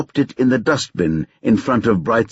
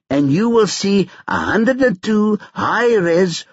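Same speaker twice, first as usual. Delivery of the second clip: put-on higher, brash young hustler taunting on the phone; cocky Tyrone; 8 semitones higher than usual. A narrator's reading. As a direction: needle him, sure you hold the cards.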